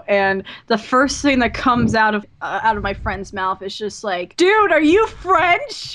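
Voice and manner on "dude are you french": loud, high, drawn-out mock astonishment; blurted amazement. Friend meeting a German, imitated loud incredulous question.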